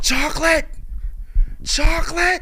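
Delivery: high, loud screaming of one word; screaming salesman. Retold or imitated. The manic customer screaming at SpongeBob and Patrick, high loud hollering. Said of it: "He starts screaming. He's hollering".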